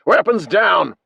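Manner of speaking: loud, high military command; shouted order. A narrator's reading. Officer calling to his troops, shouted order. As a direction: call it out hard to the whole troop. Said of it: "calls to his troops".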